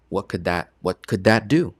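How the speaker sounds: normal talking voice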